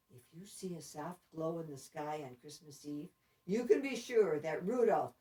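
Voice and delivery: usual speaking voice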